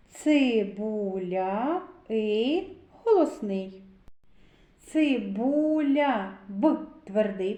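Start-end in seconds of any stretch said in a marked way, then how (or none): 0.1-1.8 цибуля slowly stretched, vowels held long; drawn-out sounding-out. Shown as a demonstration. Teacher leading children to the vowel и, slowly drawn out. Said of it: "звуковий аналіз слова цибуля". 4.8-6.4 цибуля in slow motion, each sound held; drawn-out sounding-out. Shown as a demonstration. Teacher leading children to the sound б, slowly drawn out. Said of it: "звуковий аналіз слова цибуля".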